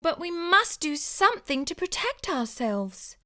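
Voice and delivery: small high urgent voice; high mouse voice. Acted. Wife urging her husband, high mousy voice. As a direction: press the point, squeaky.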